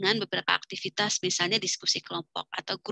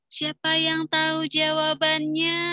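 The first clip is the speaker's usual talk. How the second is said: slow, higher questioning; asking the class; 7 semitones higher, 3 dB louder, slower than usual. Shown as a demonstration. Teacher asking class who knows, slow and high. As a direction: fish for eager answers. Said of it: "Kemudian kalau nanya".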